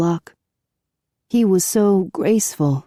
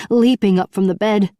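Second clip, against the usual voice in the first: ordinary delivery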